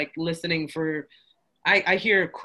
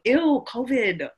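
higher, slow drawn-out ew covid; Alexis voice; 6 semitones higher, 3 dB louder, much slower than usual. Retold or imitated. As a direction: whine it like the mug's Alexis. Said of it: "she's saying".

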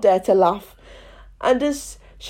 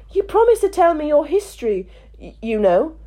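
put-on young girl voice; 6 semitones higher than usual. Alice speaks.

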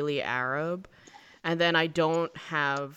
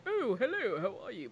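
mock British greeting, higher start, soft ending; British accent; 4 semitones higher, 7 dB quieter than usual. Shown as a demonstration. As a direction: put on a polite British accent, softly. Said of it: "but not like".

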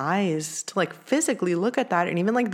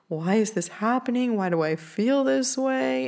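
a hint of tearful pleading, pitched higher; retold crying plea; 6 semitones higher than usual. To her boyfriend in the car, mildly tearful higher plea. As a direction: replay the teary questions lightly, not full sobs. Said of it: "like, crying, being like".

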